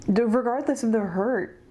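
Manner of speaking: pushing the words out through crying; emotional restart, fighting back tears; tearful. Real, not acted. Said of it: "I'm, like, crying".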